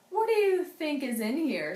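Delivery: curious dockworker voice; first man's voice. Acted. A man on the steamboat wondering about the box.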